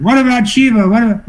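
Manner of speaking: high-pitched heckler's challenge; imitating a challenger, pitched well up; challenging. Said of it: "some of them were challenging".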